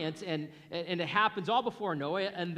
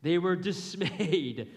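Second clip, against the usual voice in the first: laughing read; 4 semitones higher, 6 dB louder than usual. Shown as a demonstration. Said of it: "It sounds quite British".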